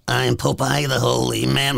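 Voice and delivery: lightly voiced Popeye; a hint of the cartoon sailor. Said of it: "My Popeye sucks these days".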